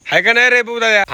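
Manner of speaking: loud, high call across the yard; calling out to Bhau across the yard, loud and high. Real, not acted. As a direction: call out to the brother. Calling out to a neighbour.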